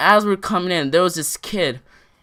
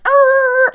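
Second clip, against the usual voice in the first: loud, very high screeching car noise; imitated car screech; 9 dB louder than usual. Telling how the car took off, loud high screech. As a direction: squeal like tires taking off.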